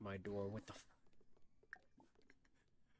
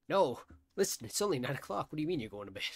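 louder, higher outraged no; loud protest; 4 semitones higher, 7 dB louder, faster than usual. Real, not acted. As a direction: call out in disbelief. Protesting the sim going to bed.

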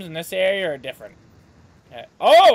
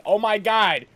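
alarmed shout; 5 semitones higher, 8 dB louder than usual. Retold or imitated. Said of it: "And be like".

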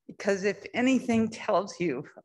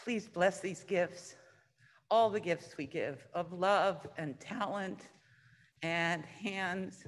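soft, hushed prayer voice; 5 dB quieter than usual. Acted. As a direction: bless the gifts gently. Leads offering prayer softly.

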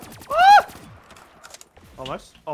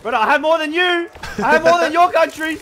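loud, higher indignant rant; angry; 8 semitones higher, 5 dB louder than usual. Real, not acted. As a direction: yell it back, offended. Heated argument over Australia.

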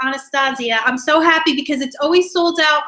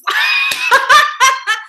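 high loud laughing burst; squealing laugh; 11 semitones higher, 5 dB louder than usual. Real, not acted. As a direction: shriek with laughter. Laughing at viewer's hands.

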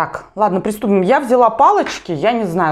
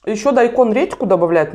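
normal talking voice

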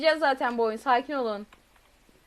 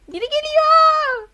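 slow, high, loud wail; drawn-out frightened wail; 12 semitones higher, 9 dB louder, slower than usual. Real, not acted. Gamer warns again as the chaser nears, drawn-out wail. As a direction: stretch the warning out in dread.

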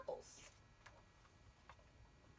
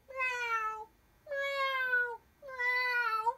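much higher, louder meowing; high, loud cat meows; 13 semitones higher, 8 dB louder, much slower than usual. Acted. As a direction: meow like a cat. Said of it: "said Cat".

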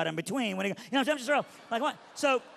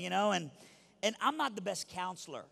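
ordinary talk, nothing marked

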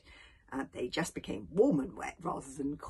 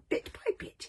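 usual speaking voice

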